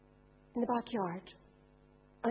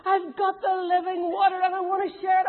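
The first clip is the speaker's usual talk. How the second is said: higher, eager announcing; excited woman; 8 semitones higher, 6 dB louder than usual. Acted. Samaritan woman telling her village, excited. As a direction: burst out with the good news.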